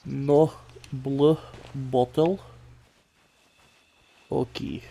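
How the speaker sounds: put-on slow, drawn-out Russian accent; a lightly put-on Russian accent. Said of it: "my Russian accent in a while".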